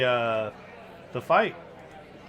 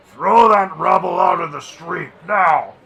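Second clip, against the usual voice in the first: loud, higher booming shout; 4 semitones higher, 12 dB louder than usual. A narrator's reading. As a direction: bellow the order across the tavern. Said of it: "you hear a booming voice".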